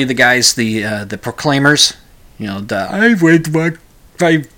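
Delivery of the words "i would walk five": slow, higher, hammed-up Scottish singing accent. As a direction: put on a mock Scots brogue. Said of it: "that's not Scottish at all".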